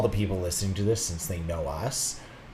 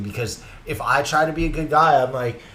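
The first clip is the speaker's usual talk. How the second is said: normal talking voice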